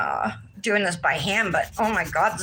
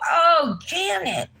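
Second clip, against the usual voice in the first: a high, bursting "oh" of remembering; sudden recollection; 9 semitones higher, slower than usual. Real, not acted. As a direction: exclaim as the memory suddenly comes back.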